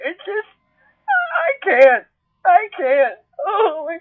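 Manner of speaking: overwhelmed, words breaking into sobs; crying. Real, not acted. Vlogger overcome by love for cats, crying and laughing.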